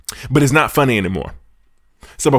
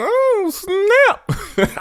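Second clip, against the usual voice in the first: Oh, snap jumped way up high in a put-on voice; mock surprise. Host imitates the crowd reacting to Kwame, squeaky mock surprise. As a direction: gasp it out like a shocked crowd. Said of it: "everybody was like".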